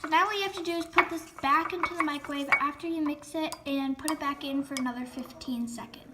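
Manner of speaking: ordinary talk, nothing marked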